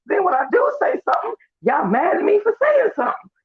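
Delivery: high, fast, whiny protest with a shouted start; exasperated self-defence. Retold or imitated. He snaps back at the room, high exasperated shout. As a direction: plead your case way up high, almost shouting. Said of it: "I'm like".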